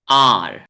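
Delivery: आर said as a long open aa ending in a clear curled r; teacher modelling आर slowly and loud; model R sound. Shown as a demonstration. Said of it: "इसको हम पढ़ेंगे".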